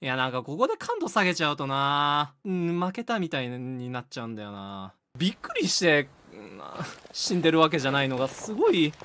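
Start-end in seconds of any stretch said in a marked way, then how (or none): none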